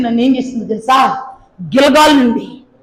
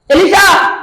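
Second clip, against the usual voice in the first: calling out; 7 semitones higher, 4 dB louder, slower than usual. Acted. Elijah calling to Elisha.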